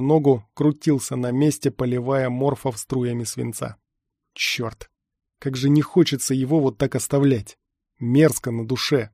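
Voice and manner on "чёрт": whispered curse. A narrator's reading. Narrator curses, leaving comrade behind.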